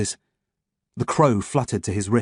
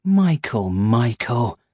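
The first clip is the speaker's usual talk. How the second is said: mock-disappointed scolding; tutting; much slower than usual. A narrator's reading. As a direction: tut at him like a naughty child. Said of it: "he tutted".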